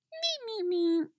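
meep meep meep squeaked very high and soft; a tiny high consoling squeak on meep; squeaky Beaker meeps. Acted. Beaker consoling Parker, meeping very high and soft. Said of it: "Beaker says".

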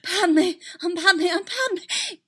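high, loud gasped cries, breathless urgency; desperate gasp. A narrator's reading. Padme fighting for herself in the darkness, desperate gasp. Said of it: "heard herself gasp".

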